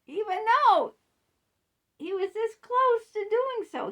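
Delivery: high, loud frustrated stress; exasperated emphasis. Real, not acted. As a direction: push the words in disbelief.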